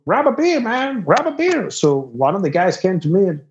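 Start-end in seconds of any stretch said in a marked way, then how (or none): 0.1-1.7 high-pitched, insistent imitation; voiced party host. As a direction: play the local pushing beers, pitched way up.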